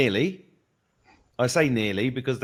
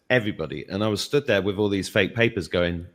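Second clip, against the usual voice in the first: ordinary talk, nothing marked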